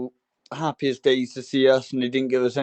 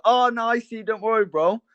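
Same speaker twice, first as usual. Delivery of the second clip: higher-pitched, quick-to-reassure imitation; acted reassurance; 8 semitones higher than usual. Cyclist answering skater's car warning, acted reassurance.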